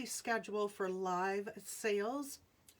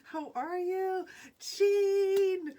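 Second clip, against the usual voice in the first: excited greeting; 9 semitones higher, 10 dB louder, much slower than usual. Real, not acted. Friend joins live, excited greeting.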